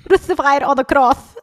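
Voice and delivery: put-on thick Korean accent. Youth group member imitates her pastor's favorite saying, botched Korean accent. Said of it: "had a really thick Korean accent".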